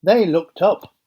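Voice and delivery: ordinary delivery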